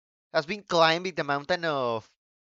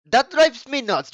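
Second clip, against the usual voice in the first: higher, louder and slower rant; really riled-up outburst; worked up; 8 semitones higher, 8 dB louder than usual. Real, not acted. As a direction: let the frustration burst out. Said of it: "I'm getting excited talking about this".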